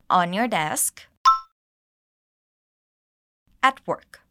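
slow, in short chunks with long pauses; broken into chunks. Shown as a demonstration. Teacher breaking the coworker's sentence into chunks, slowly. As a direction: say it piece by piece for learners. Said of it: "breaking it down".

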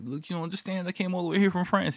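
high-pitched mock pleading; arguing hiker. French hiker arguing back at staff lady, high mock-protesting voice.

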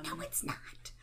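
hushed voice from upstairs; whispered offstage voice. Acted. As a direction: whisper it like someone listening in. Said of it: "From upstairs".

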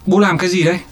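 high, startled question; surprised. A narrator's reading. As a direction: blurt it out in surprise. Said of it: "Tú Khỉ kinh ngạc".

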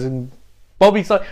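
ordinary delivery

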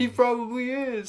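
high, slow mock show-host voice; game host voice. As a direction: deliver it like a TV host teasing. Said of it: "like a game host".

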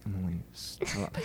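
a hint of a hushed plea; quiet imitated hush. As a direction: say it low, held back. Boyfriend quietly restraining Emily.

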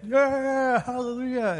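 high, drawn-out imitation of an excited cheer; mock hallelujah cheer. Preacher imitating people who get it, high excited cheer. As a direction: cheer like a thrilled congregant, stretched out. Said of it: "and some people get".

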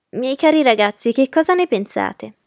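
high, loud protest; high-pitched appeal to the young men; exclaiming lady. A narrator's reading. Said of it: "esclamò Lady Lynn".

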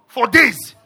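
normal talking voice

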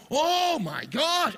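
high, drawn-out wail of disbelief; slow, swooping melodramatic exclamation; mock dismay. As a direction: stretch it out in exaggerated dismay. Acting someone who lost sports.

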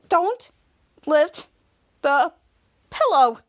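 word by word with pauses; halting warning. Acted. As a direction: space out each word of the order. Acted order, said word by word.

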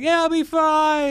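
very high, slow, sing-song reassurance; coaxing parent. Retold or imitated. Parents coaxing their scared daughter, high sing-song. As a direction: coax a frightened child, drawn out.

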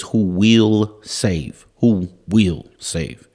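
slow, weighted "will", each word set apart; stress on WILL. Real, not acted. Preacher ruling out shoulda, coulda, woulda, stressed WILL. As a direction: hammer "will" slowly, then repeat it. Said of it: "None of the shoulda, coulda, woulda".

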